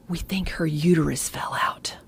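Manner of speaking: hushed, voiceless whisper of a scared nurse. Retold or imitated. Nurse pulling the doctor aside, whispering. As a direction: whisper it as if sharing a secret. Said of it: "She pulls me aside".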